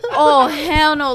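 loud, slow laughing protest; laughing refusal. Retold or imitated. Girl refusing to let the medic remove her boot, loud and slow.

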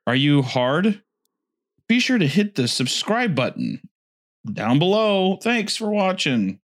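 high, mock video-host sign-off; high outro voice, drawing out the last words; Watch Mojo UK narrator. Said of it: "Thank you for watching Watch Mojo U K".

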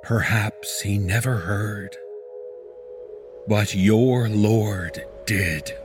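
usual speaking voice

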